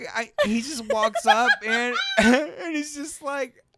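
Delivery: high, loud, laughing attempt to continue; cracking up. Real, not acted. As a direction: struggle to get the story out between laughs. Tries to resume story while laughing.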